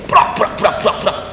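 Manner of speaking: banging imitation. Imitating parents banging door.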